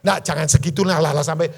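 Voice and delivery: loud scolding sermon; preacher loudly telling them to stop sulking; loud preaching. Real, not acted. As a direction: rebuke the congregation loudly. Preaching louder.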